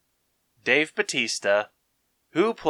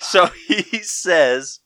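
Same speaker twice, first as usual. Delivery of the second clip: laughing; 7 semitones higher, 6 dB louder, slower than usual. Real, not acted. Laughing while retelling scene.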